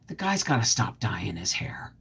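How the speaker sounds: whispered catty aside. Real, not acted. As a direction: lean in and whisper it like gossip. Catty aside about an actor.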